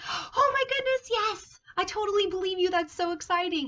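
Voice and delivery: high, loud gushing excitement; hammed-up thrilled believer; excited. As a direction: squeal it like you totally believe. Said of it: "doesn't look like he's like".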